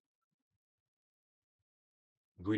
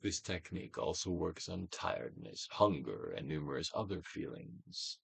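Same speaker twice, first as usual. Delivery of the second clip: guided meditation; 4 dB louder than usual. Acted. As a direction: coax the listener to let feelings pass.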